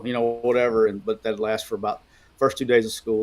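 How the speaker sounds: ordinary talk, nothing marked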